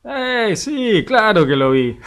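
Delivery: the enemy shrugging off God's question; smug enemy. Acted.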